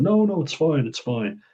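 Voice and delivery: squeaky high no no, dropping at the end; mocking a sly fake-generous shrink; high mock insistence. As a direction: ham up the fake modest refusal. Shrink insisting it's fine.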